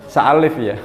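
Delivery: normal talking voice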